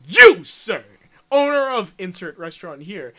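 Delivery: big, loud announcer delivery; loud prize announcement. Retold or imitated. Prank caller addressing the restaurant owner, loud announcer style. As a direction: proclaim it to the lucky winner.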